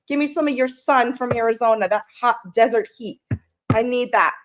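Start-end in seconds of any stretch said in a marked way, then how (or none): none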